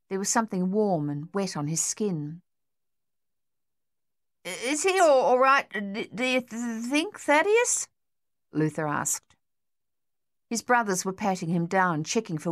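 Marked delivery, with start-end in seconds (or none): 4.4-7.9 much higher, halting worried stammer; anxious stutter. A narrator's reading. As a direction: stammer in fear for your brother.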